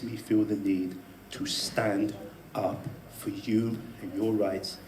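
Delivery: soft, slow, breathy whisper of conviction; sincere whisper. Real, not acted. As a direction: drop to a whisper, meaning every word. Earnest closing confession.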